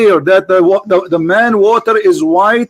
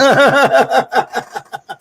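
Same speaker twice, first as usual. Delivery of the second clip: a brief scoffing laugh; short laugh; 5 semitones higher than usual. Real, not acted. Debater laughing at his opponent's hair-splitting. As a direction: laugh off the objection. Scoffs at rival's word distinction.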